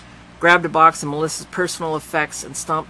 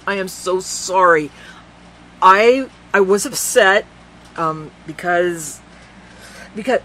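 broken apology; 3 semitones higher, 7 dB louder, slower than usual. Acted.

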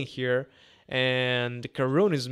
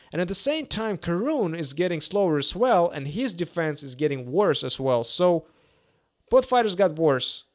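play-by-play; 3 semitones higher, 5 dB louder than usual. Real, not acted. Live boxing commentary.

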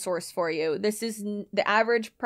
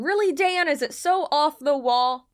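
incredulous retort; 6 semitones higher, 4 dB louder than usual. Acted. Retorting to script author.

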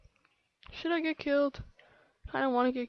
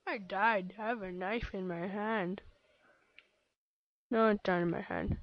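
ordinary delivery

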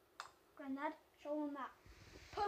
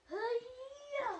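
magic flourish shout; 10 semitones higher than usual. Pretend. Kid doing magic flourish.

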